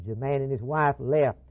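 ordinary talk

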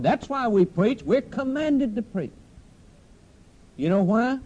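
high-pitched, urgent pulpit delivery; impassioned preaching. Real, not acted. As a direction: drive the point home high in the voice. Preacher drives the point home.

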